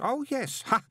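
put-on high-pitched, absorbed young enthusiast; earnest boy pin expert. A narrator's reading. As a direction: lecture eagerly in a high boy's voice.

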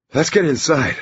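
high-pitched, slightly winded urging; puffing. A narrator's reading. Teacher urges them indoors, puffing after striding up. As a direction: push it out as if a bit puffed. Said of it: "he puffed".

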